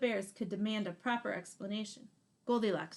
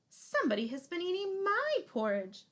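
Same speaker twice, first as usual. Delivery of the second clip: higher mama bear voice; a higher, motherly bear; 9 semitones higher, 4 dB louder than usual. Acted. Mama Bear finds her porridge eaten, higher voice. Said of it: "said Mama Bear".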